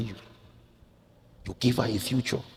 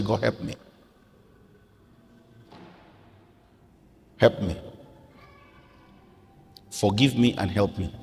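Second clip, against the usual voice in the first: model prayer. Shown as a demonstration. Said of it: "Just say".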